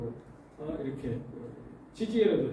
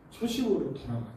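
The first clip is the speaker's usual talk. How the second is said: put-on 초심으로 said softer in an old pastor's voice; a hint of the retired pastor; retired pastor's version; 3 dB quieter than usual. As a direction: lightly voice an elderly pastor, 초심으로 falling gently. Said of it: "은퇴하신 목사님".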